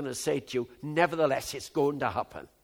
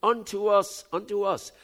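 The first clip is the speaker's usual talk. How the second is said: ordinary talk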